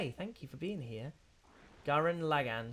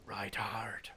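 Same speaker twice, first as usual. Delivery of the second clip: whisper; 4 semitones lower, 4 dB quieter than usual. Retold or imitated. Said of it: "he goes slightly West Country".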